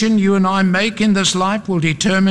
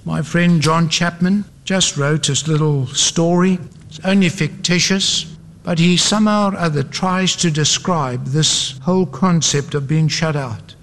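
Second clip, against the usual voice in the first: ordinary talk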